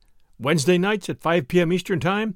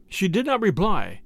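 ordinary delivery, nothing marked

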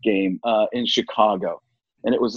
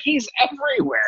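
exasperated retort; 13 semitones higher than usual. Retold or imitated. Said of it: "I'm like".

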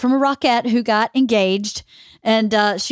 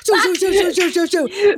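higher, breathy shoo shoo for a dash; laughing running noises; 8 semitones higher than usual. Retold or imitated. Scared of the street, laughing mock sound of running home. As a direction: mimic your feet scurrying, laughing.

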